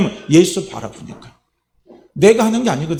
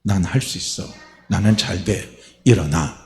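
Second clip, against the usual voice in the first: quiet declaration; 7 semitones lower than usual. Shown as a demonstration. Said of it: "된다는 걸 자꾸 선포해야죠".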